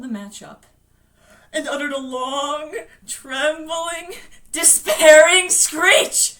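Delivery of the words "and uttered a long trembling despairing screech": building to a loud, drawn-out peak; rising intensity. A narrator's reading. Narrator describing Bill's screech, building to a cry. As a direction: push higher and louder, stretching the last word.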